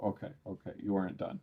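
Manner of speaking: ordinary delivery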